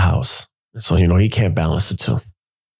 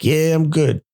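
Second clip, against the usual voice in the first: higher-pitched put-on cheer; mock-cheerful reassurance; falsely upbeat; 7 semitones higher, slower than usual. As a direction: cover up with an upbeat tone. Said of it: "I wasn't upbeat about it".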